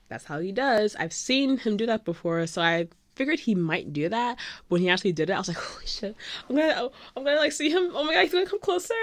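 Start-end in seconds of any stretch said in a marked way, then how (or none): 5.6-8.9 rising, high-pitched fangirl fluster; excited panic. Retold or imitated. Fan seeing her idol come closer, flustered excitement. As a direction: get higher as he comes nearer.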